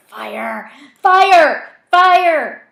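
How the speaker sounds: loud cries climbing to a high scream; escalating scream. A narrator's reading. Voices begging gunmen to end it, screaming. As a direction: build from a shout to full screaming.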